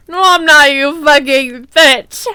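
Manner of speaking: sobbing, furious yell; angry crying shout. Acted. Draco yelling at the narrator through tears. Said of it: "he shouted angrily".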